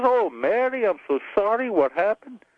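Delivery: high, sympathetic Irish priest; Irish accent. Acted. Parish priest to newly widowed Mary, Irish accent. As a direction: console her in a joke Irish accent. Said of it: "you wanna hear an Irish joke".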